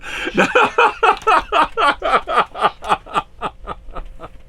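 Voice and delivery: long high-pitched laughter; amused high laughter. Real, not acted. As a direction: burst into a high cackle. Laughs after joke about kid.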